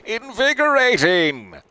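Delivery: very high, loud, slow drawn-out shout; big yell. Acted. Louise yelling from the water, long loud shout. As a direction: stretch it out like a whoop across the beach.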